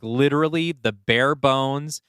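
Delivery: ordinary talk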